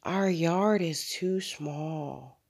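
very slow, soft sigh on the words; drawn-out, sighing mother. A narrator's reading. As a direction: let it out in one long sigh. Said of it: "Mother sighed".

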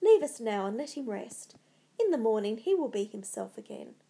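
ordinary delivery, nothing marked

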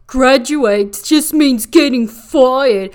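loud, slow mock declaration; blunt outburst. [name] translating the euphemism aloud, loud and blunt. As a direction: blurt it out, each word drawn out.

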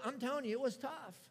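usual speaking voice

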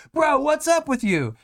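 mock guy friend demanding answers; hammed-up high bro; baffled classmate. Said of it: "And they're like".

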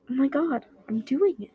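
high, thrilled exclamation; excited. A narrator's reading. Himiko standing for the first time, thrilled. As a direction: burst out in delight.

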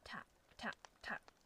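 breathy whispered sound effect; whispered tapping. Acted. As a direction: whisper each tap softly. Pills softly hitting the floor.

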